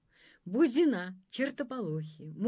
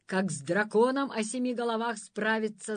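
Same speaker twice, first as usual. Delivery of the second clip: usual speaking voice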